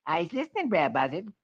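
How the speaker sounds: high, sly cartoon rabbit. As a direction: chirp it back knowingly. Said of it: "Brer Rabbit replies".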